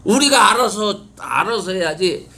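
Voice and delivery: usual speaking voice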